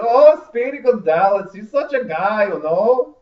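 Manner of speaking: high, loud, slow fake Spanish accent; bad Spanish accent. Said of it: "worst Spanish accent I've ever heard".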